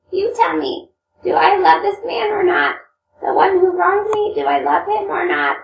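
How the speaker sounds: Grushenka. Acted. Reads Grushenka's pleading questions.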